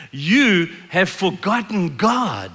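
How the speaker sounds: slow, drawn-out accusation; stern accusation. Acted. Moses confronting the children of Israel, stern accusation. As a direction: confront them, stretching each word.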